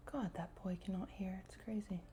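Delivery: hushed aside, voice dropped; whispering. Real, not acted. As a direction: whisper it under your breath. Complaining under her breath.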